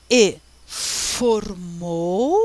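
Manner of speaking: very slow "e formou", stretched out and rising; drawn-out translation. Shown as a demonstration. Teacher translates the Hebrew for students, very slowly drawn out. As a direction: draw out each syllable, lifting the last.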